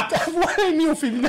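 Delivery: high, laughing through the words; giggling while teasing. Real, not acted. Teasing about a feminized voice.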